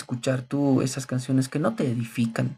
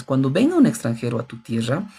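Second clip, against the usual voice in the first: ordinary talk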